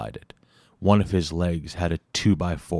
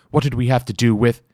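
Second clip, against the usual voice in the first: fast, higher, louder denial; flustered; 6 semitones higher, 6 dB louder, faster than usual. Acted. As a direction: Foster rushes his denial, fast and higher. Villain flustered, defensive.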